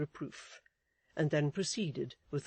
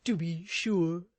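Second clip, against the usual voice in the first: raised, fussy husband voice; Mister Chick's voice; 3 semitones higher, 5 dB louder, slower than usual. A narrator's reading. Husband fussing at his wife, in a raised fussy voice. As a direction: fuss over his wife anxiously.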